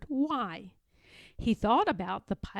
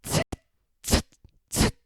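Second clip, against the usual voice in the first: mock monkey chatter, each tsit clipped short; playful monkey sounds; 4 dB louder than usual. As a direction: squeak it like a monkey. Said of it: "The monkeys replied".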